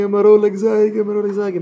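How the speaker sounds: high mock cry of alarm; imitating a frantic repeated wail; mimicked screaming. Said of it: "in der Tunge von Geschrei".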